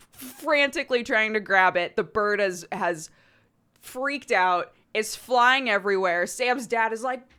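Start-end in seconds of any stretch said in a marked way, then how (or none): none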